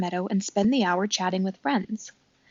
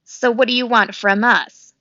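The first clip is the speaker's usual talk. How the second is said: growl; 3 semitones higher, 7 dB louder than usual. A narrator's reading. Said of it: "growled Fink".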